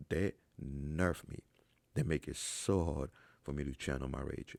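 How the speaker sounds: ordinary talk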